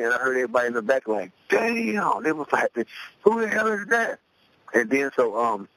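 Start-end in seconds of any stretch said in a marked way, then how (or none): 1.5-4.2 much higher, hyped exclamations; amazed crowd. Retold or imitated. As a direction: react like you can't believe that rap.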